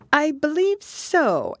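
slow, high, musing reply; thoughtful. A narrator's reading. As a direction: think it over slowly in a high voice. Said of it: "Alice replied thoughtfully".

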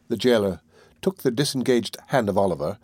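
ordinary delivery, nothing marked

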